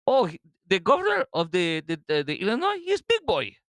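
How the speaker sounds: hammed-up, high Russian accent, halting. Radio host mocking the Illinois governor, put-on Russian accent. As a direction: stumble through a mock Russian accent, soaring at the end. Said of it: "a Russian accent".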